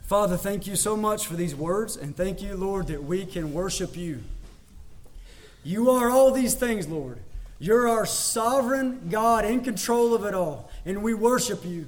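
soft, reverent prayer voice; closing prayer. Acted. Pastor praying aloud for his congregation, soft reverent tone. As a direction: pray gently over the church.

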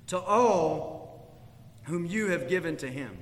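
Jesus praying to the Father; reverent prayer reading. Acted. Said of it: "lifted up his eyes to heaven and said".

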